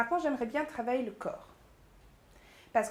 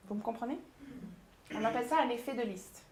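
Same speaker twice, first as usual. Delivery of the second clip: ordinary delivery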